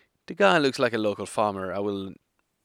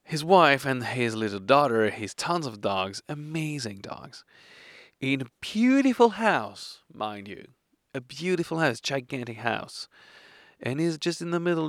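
ordinary delivery

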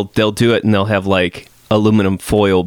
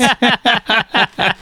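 high laugh; 12 semitones higher than usual. Real, not acted. Laughs after flub.

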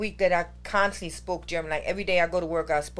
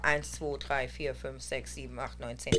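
counting in German, trailing off quieter; counting under breath; 7 semitones lower, 7 dB quieter than usual. Shown as a demonstration. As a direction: count to yourself, fading to a murmur. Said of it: "every time I count".

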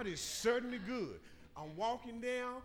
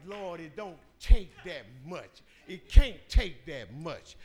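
mocking churchgoers; 3 semitones lower, 13 dB louder than usual. People mocking her shouting in church.

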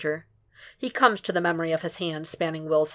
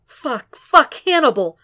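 building from a gasp to a much higher, louder cry; Will crying out at climax, higher and louder; climactic shout; 8 semitones higher, 8 dB louder, slower than usual. A narrator's reading. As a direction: break into a full cry of his name. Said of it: "Will climaxes with a shout".

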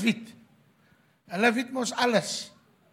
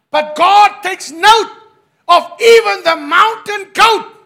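loud preaching; 9 semitones higher, 16 dB louder than usual. Acted. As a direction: proclaim it at full voice. Shouted second take.

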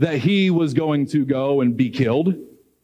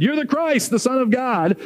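excited declaration; 8 semitones higher than usual. Retold or imitated. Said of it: "jumped up and said".

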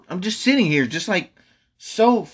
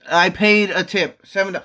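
normal talking voice

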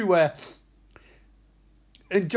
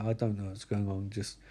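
low, hushed resignation; acted weary mumble; lost father; 11 semitones lower, 10 dB quieter than usual. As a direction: murmur it helplessly. Voicing bewildered, defeated father.

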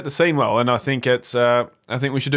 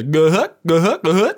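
high, loud goofy bear grunts; Banjo imitation; 6 semitones higher, 5 dB louder than usual. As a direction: bounce out cheery bear noises. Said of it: "Banjo just goes".

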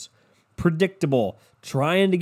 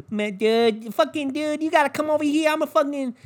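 higher Boston tough-guy voice; Boston accent; 11 semitones higher than usual. Retold or imitated. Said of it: "you know, Boston".

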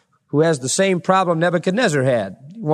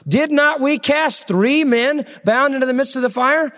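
high, slow, amazed king's question; a touch of a stunned king asking; astonished king; 8 semitones higher than usual. Acted. King astonished, asks his counselors.

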